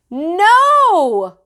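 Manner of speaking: put-on high, loud angry child; toddler yell. A narrator's reading. Fudge rejecting the dictionary, high loud yell. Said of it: "he yelled".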